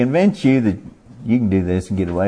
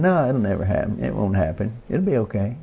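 softer, coaxing voice in the mind, lifting at the end; tempting inner voice; 4 dB quieter, faster than usual. Acted. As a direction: play the quiet little tempter brushing off worries. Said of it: "you hear this voice in your mind saying".

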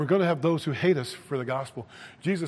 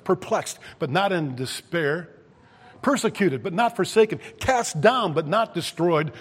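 raised, loud scriptural contrasts; forceful preaching; 4 semitones higher, 6 dB louder than usual. Acted. Preacher quotes Paul on being persecuted but not forsaken, forceful preaching. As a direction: punch out each contrast like a preacher.

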